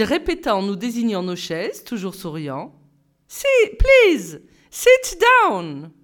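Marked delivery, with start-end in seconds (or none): 3.3-5.9 put-on high, loud, English accent; cheery order. A narrator's reading. Simon telling the pupils to sit, high and loud. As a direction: sing out the order.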